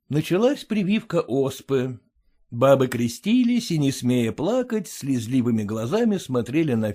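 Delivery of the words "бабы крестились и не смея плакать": ordinary delivery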